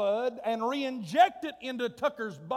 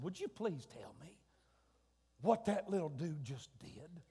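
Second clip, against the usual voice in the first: much softer, lower, confused aside; hushed question; 6 semitones lower, 11 dB quieter than usual. Retold or imitated. Father quietly asking the doctor, hushed and baffled. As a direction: drop to a hush and ask puzzled. Hushed after the scene calms.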